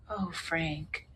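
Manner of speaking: soft, pitying sigh of a name; hushed sympathy. Retold or imitated. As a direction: imitate someone quietly taking in bad news. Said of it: "like that".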